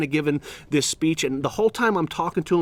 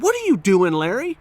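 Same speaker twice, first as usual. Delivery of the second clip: retold teacher's challenge, higher and louder; stern confrontation; 6 semitones higher, 5 dB louder than usual. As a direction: call out a misbehaving pupil. Teacher confronts student in story.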